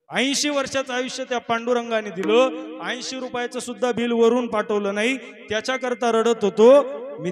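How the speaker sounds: imitating an old father's impassioned, loud outcry; loud lament. Old father explaining his tears to his daughter, loud lament. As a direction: declaim the grievance at full volume, pitch often lifted. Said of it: "मी मोठमोठ्याने रडतोय".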